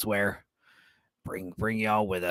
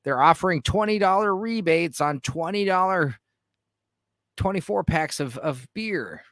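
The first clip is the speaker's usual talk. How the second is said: retold excitement, pitched up; mildly hammed-up eager surprise; excited; 6 semitones higher than usual. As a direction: act out perking up at a bargain. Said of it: "I was excited 'cause I was like".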